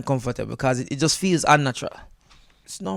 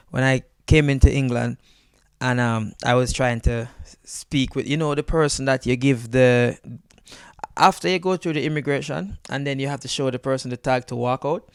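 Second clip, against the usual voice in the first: ordinary talk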